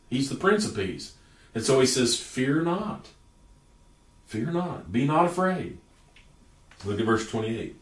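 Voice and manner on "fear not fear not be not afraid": gentle reassurance. Acted. Jesus comforting frightened disciples.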